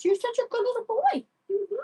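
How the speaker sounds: squeaky high coo of praise; high baby praise. Acted. Owner praising her guinea pig in high baby talk. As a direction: gush at the pet up high.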